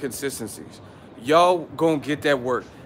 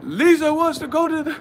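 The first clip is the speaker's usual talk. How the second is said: movie character; 13 semitones higher, 5 dB louder than usual. Retold or imitated. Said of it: "He's like".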